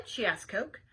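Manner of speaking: ordinary delivery, nothing marked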